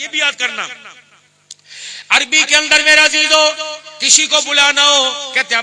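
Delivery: loud, high, impassioned sermon; fiery preaching. Acted. Preacher calling the congregation to rise for prayer, loud and fervent. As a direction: call the sleepers to prayer from the pulpit. Preaching about the dawn call.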